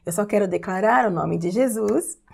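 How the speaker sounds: ordinary delivery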